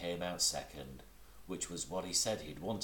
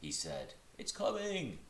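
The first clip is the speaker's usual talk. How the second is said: very high, eager squeak; excited Piglet. Acted.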